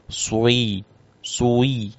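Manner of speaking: "Sui" with the lips pushed forward, so a w sound creeps in; rounded-lip demo. Shown as a demonstration. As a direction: puff the lips so it comes out "sui". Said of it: "Biasanya begini suaranya".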